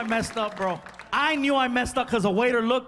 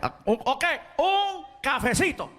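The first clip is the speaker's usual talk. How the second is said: put-on Cuban waiter accent; 4 semitones higher than usual.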